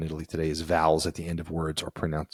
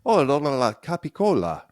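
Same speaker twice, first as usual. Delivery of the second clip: high, louder, sing-song mock Italian; imitated Italian speaker; 9 semitones higher, 5 dB louder than usual. Co-host imitating Italians saying the full word, high mock Italian. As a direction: imitate an Italian savoring every syllable. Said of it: "When you hear them say".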